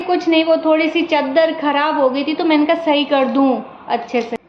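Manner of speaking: normal talking voice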